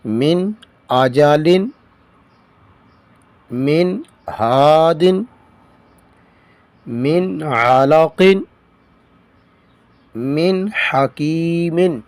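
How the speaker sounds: slow, careful recitation of the phrases; fluent recitation. Shown as a demonstration. Teacher reading the line fluently for students, slow recitation. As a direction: read each phrase out in one flowing go. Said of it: "اب اس کو رواں پڑھتے ہیں".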